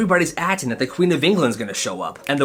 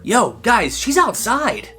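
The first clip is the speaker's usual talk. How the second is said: excited; 8 semitones higher, slower than usual. Acted. Kid calling out.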